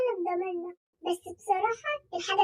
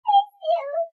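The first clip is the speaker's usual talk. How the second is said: fake crying; 7 semitones higher, 5 dB louder than usual.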